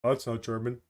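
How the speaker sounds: low, soft mock dismissal; imitated skeptic. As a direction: mimic an unconvinced doubter under your breath. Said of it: "and be like".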